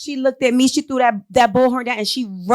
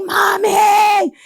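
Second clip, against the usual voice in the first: high desperate cry of Mommy; retold child's scream; 10 semitones higher, 4 dB louder, much slower than usual. Son on the balcony screaming to his mother, high pleading cry. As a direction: call out for mom, pleading and loud. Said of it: "He's screaming".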